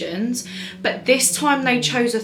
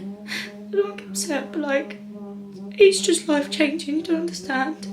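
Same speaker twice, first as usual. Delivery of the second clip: higher, choked-up voice as tears come; holding back real tears; voice breaking, upset; 6 semitones higher than usual. Real, not acted. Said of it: "Getting upset".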